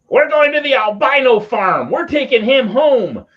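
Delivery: loud, high holler imitating the reverend; backseat outburst. Reverend hollers from the back seat at the border, high loud yell.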